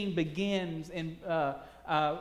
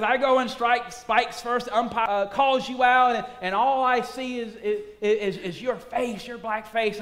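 higher and louder, building aggression; Rickey's hot-headed taunt; 6 semitones higher, 6 dB louder than usual. Acted. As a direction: provoke him hard. Rickey's heated scenario.

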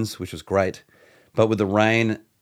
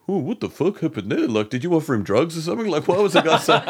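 mock bewildered mate; 6 semitones higher than usual. Cousin's retold puzzled question.

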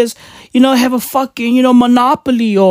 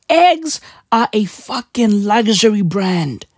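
usual speaking voice

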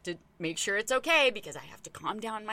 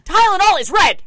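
much louder and higher, hammering Tylenol; loud indignant repeat; 9 semitones higher, 18 dB louder than usual. Real, not acted. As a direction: shout it in disbelief.

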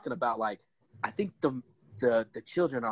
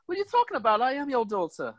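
high-pitched hammed-up movie quote; comic high-voiced impression of the film; mock movie characters; 12 semitones higher than usual. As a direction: put on a high, silly accented character voice. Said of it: "Or stuff like that".

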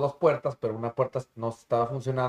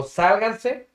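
sharp raised call to get out; urgent shout; 8 semitones higher, 6 dB louder than usual. Retold or imitated. Telling relatives to leave the house, urgent shout. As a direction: yell at them to get out.

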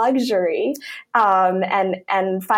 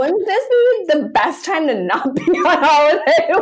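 laughing disbelief; 8 semitones higher, 5 dB louder than usual. Retold or imitated. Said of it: "I'm like".

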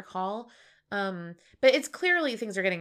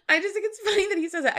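laughing; 12 semitones higher, 5 dB louder, much faster than usual. Real, not acted. Laughing while explaining.

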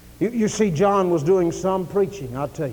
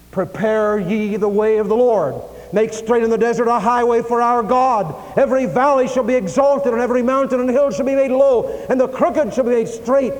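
high, ringing pulpit recitation; impassioned Bible recitation; declaimed scripture; 5 semitones higher, 5 dB louder than usual. Real, not acted. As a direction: proclaim the prophecy in rising waves. Declaims scripture at full force.

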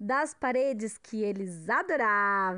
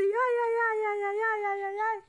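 scared whimpering; 11 semitones higher than usual. Acted.

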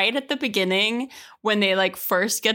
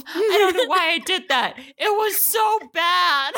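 laughing quote; 8 semitones higher than usual. Retold or imitated. Quoting Emily's confession to her co-host, laughing hard and higher. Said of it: "And she's like".

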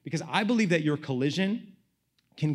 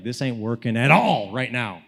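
loud, drawn-out exasperation; exasperated; 7 dB louder than usual. Retold or imitated. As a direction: complain to God, leaning hard on the words. Retells frustration at failed prayer.

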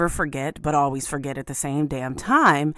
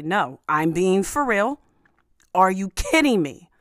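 louder, emphatic mock outrage; all caps rage; 2 semitones higher, 5 dB louder than usual. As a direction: act out an exasperated all-caps tweet. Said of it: "in all caps rage to just say".

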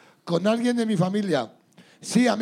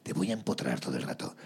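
much lower, softer seductive growl; macho stripper character; 9 dB quieter than usual. Retold or imitated. To the crowd as his stripper character, low and seductive. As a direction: croon it like a cocky stage stud. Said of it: "tener un personaje que era como muy, muy de".

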